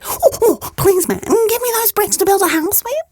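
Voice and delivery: high, pleading piglet; little pig voice. Acted. Pig asks the man for furs, high and pleading. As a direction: beg sweetly like a small pig.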